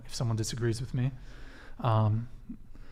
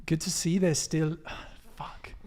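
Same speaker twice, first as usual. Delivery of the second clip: bad Australian accent, clearly fake; 3 semitones higher than usual. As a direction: attempt an Aussie accent, badly. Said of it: "Let me see if I can do an Australian accent".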